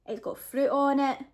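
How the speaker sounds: ordinary talk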